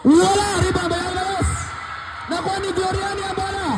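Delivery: excited call. Real, not acted. Commentator follows the loose-ball scramble, fast excited calls. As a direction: ride the frantic scramble.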